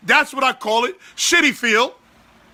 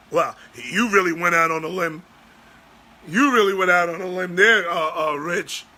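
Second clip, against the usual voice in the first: mock deep sarcasm; 7 semitones lower than usual. Mocking the caller's safe pick.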